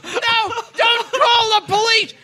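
high, hammed-up begging; pleading cry. Caught model begging her boss, in a high mock-panicked plea.

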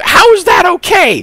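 very high, loud outburst; outraged shout. Acted. Reacting to news that Rosie died, outraged high shout. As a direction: shriek it in disbelief. Outburst at the death being okay.

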